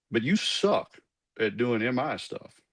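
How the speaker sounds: imitated Southern good old boy drawl; Colonel Waldo's thick Arkansas accent. Colonel bluntly tells cadet he's no good, thick Southern accent. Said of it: "really thick Southern Arkansas accent".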